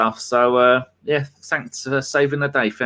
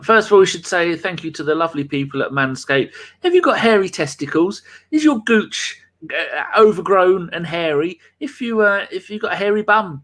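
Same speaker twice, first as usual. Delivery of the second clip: higher, brighter sponsor pitch; ad read; 4 semitones higher than usual. Acted. Host reading the Manscaped sponsor spot, upbeat ad read. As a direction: sell it like a cheeky ad.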